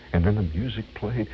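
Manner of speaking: ordinary delivery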